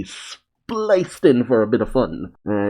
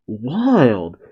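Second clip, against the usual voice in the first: ordinary talk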